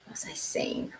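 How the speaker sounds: lost your train of thought, whispered; soft whisper; whispered aside. Real, not acted. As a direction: whisper it to yourself. Aside after greeting cat.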